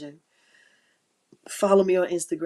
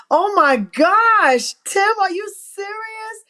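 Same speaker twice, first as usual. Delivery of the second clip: higher and louder, drawn out in disbelief; real joyful astonishment at a viewer's comment; delighted surprise; 14 semitones higher, 11 dB louder, slower than usual. Real, not acted. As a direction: squeal it in happy shock.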